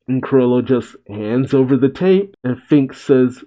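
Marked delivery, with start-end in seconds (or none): none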